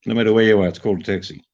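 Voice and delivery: usual speaking voice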